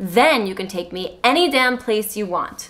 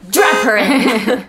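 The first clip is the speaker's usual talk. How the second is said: slow, louder, hissed; mock vampire hiss; 5 dB louder, much slower than usual. Edward ordering Emmett, slow mock hiss. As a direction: strap it in with menace. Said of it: "Edward hisses at Emmett".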